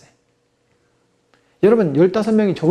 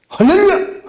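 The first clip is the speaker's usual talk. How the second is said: high, sudden hallelujah shout; exclaimed greeting; 12 semitones higher, 4 dB louder than usual. Retold or imitated. Pastor on second salon visit, exclaimed greeting. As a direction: burst in with a high call.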